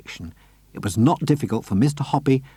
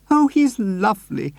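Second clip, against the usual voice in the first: much higher doting lady; Missus Silver's voice; 10 semitones higher than usual. A narrator's reading. Missus Silver fussing over her tortoise, high and doting. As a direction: fuss over her pet.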